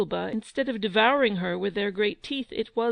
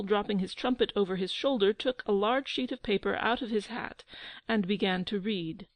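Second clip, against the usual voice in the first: plain reading voice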